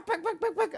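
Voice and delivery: high-pitched imitated slaps; slap sounds. Preacher acting out a midwife slapping a baby, high mock slaps.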